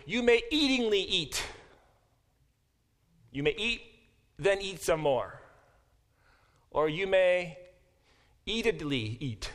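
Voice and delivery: high, insistent, leaning on eatingly; mock-literal emphasis to show the Hebrew doubling; teacher hamming up "eatingly eat" for the class; playful emphatic paraphrase. Shown as a demonstration. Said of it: "it's like saying".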